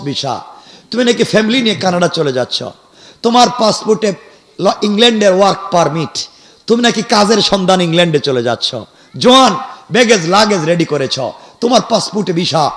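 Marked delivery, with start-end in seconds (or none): none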